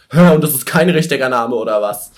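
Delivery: laut und hoch ungläubig, halb lachend. Retold or imitated. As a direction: nachgespielte Verwirrung, lauter. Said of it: "war ich so". (ungläubig empörte Nachfrage)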